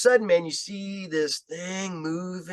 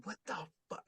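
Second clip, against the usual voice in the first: much softer, under-the-breath what the fuck; muttered disbelief; 5 semitones higher, 18 dB quieter, faster than usual. Retold or imitated. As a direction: say it to yourself, stunned. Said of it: "And I'm sitting there going".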